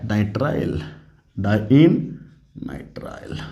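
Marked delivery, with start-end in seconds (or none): 2.7-3.4 breathy whispered answer, barely audible. Acted. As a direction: drop to a hushed whisper for the answer. Teacher whispering the answer aside. (फुसफुसाकर जवाब देना)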